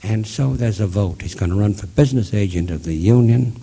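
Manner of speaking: ordinary talk, nothing marked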